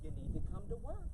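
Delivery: soft, sing-song coaxing of a boss; mock sweet soft scolding. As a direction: gently coax a slacking employee. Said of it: "And we talk like this".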